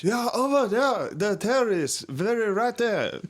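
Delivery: high broken English pointing; hammed-up accent, pointing. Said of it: "he's like".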